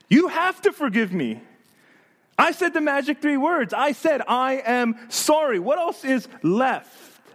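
high-pitched, acted demand for forgiveness; mock-aggrieved self-justification; angry insistence. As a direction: press it indignantly, slowing toward the end. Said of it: "they become angry and they raise their voice".

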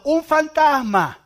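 high, stretched-out frightened cry; terrified cry. Acted. Disciples on the boat crying out in fright, high and drawn out. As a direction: cry out in terror, slowly.

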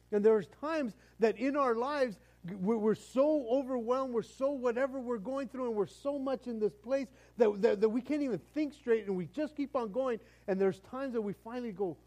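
high, loud, driving sermon voice; impassioned preaching. Real, not acted. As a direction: preach it at full force. Preaching at full force.

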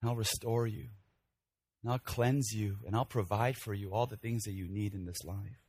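soft, low and hushed, gently reassuring; hushed, tender vow; tender father's promise. Acted. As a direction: promise forgiveness softly, like a loving father. Father's promise to returning son.

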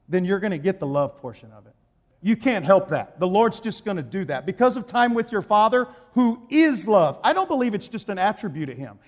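normal talking voice

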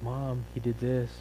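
put-on slow, drawn-out child's complaint; tattling little kid. Bickering disciple tattling to mom, mock childish whine. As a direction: drag it out like a kid telling on a sibling. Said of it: "they're just being like little kids".

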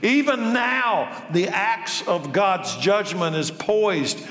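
high, stern prophet's rebuke; John the Baptist scolding the Pharisees, fierce preaching voice; John the Baptist denouncing. Acted. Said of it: "He denounced them. "You brood of snakes," he exclaimed".

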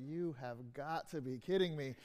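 a man at the end of his rope; dejected disbelief. Retold or imitated. Man discovers his car was robbed, weary despair. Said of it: "two different stories where they have the same words".

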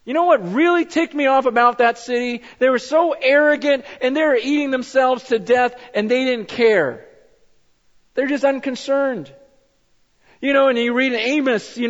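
preacher railing against Sodom's complacency; fired-up preaching. Real, not acted. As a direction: let the anger at Sodom ride.

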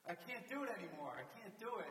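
imitated exhausted player's high outcry; crying out, screaming. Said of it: "he's crying, screaming out".

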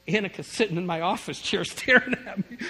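can't hold back the laugh at being caught. Real, not acted. Caught by daughter mid-prayer.

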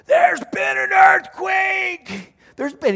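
imitating a frantic friend shouting to his family; panicked yell. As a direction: bellow it like a man sure the quake just hit. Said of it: "he starts yelling to his family".

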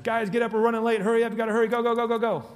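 loud, high rushing call; frantic parent shouting go go go; hurried yell upstairs. Retold or imitated. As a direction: hurry late kids from downstairs. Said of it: "in the morning from downstairs".

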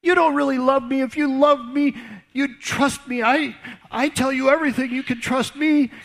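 high, whiny mock-hurt woman's voice; pouty Delilah. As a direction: play the wounded lover, pleading. Delilah nagging, same voice.

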